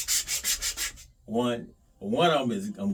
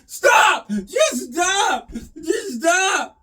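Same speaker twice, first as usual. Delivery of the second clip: high, loud, whiny pleading shouts; mock outraged fans; 8 dB louder, much slower than usual. Mocking Gibson purists begging him to stop, high whiny shouting. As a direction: beg him to stop like a horrified fan. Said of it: "you Gibson guys going".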